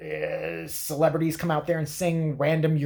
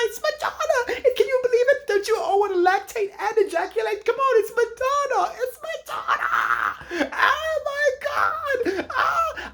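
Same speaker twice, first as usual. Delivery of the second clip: very high screaming, laughing and sobbing with joy; hysterical fan shriek; 3 dB louder, slower than usual. Retold or imitated. Comic mimicking the blonde host screaming over [name]. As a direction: lose it completely like a crazed fan. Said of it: "It's like".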